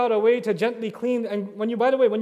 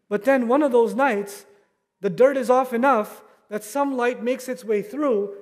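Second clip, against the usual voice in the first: impassioned preaching; 4 semitones higher than usual. Acted. Imam urging the Ramadan congregation, rising preaching. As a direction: lift the sermon up with fervour. Preaching builds higher and louder.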